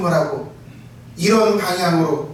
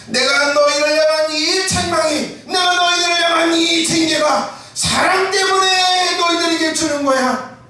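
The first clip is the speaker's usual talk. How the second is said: Jesus pleading in love; 10 semitones higher than usual. Acted. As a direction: cry out the love behind the rebuke. Jesus voiced, shouted slowly.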